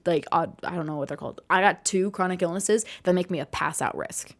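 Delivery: usual speaking voice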